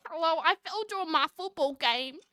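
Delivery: hammed-up nerd character. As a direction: do a nerdy boy explaining himself. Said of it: "like, the nerd voice".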